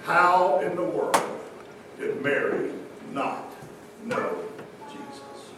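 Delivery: softly posed mystery; hushed question. Real, not acted. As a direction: drop to a near whisper.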